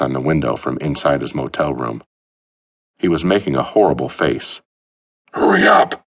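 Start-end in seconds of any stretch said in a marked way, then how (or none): 5.3-6.1 high, loud muffled shout. A narrator's reading. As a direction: yell through the window glass. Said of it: "shouted President Grant".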